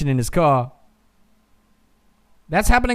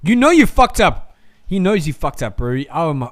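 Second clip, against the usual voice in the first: ordinary delivery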